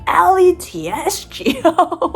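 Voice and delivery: slow spelled-out cheerleader chant; high school cheer. Retold or imitated. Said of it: "pulling out my fucking high school cheer shit".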